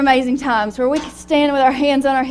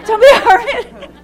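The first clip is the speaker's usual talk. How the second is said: "Don't be a hermit" repeated through laughter, higher and louder; laughing; 11 semitones higher, 8 dB louder than usual. Acted.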